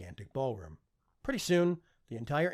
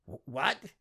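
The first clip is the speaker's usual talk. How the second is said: stammering; 4 semitones higher than usual. A narrator's reading. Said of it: "Lord Gilder stammered again".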